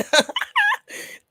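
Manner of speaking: very high squeaky giggle; a real squealing laugh. Real, not acted. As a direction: let out a shrill burst of laughter. Burst of laughter.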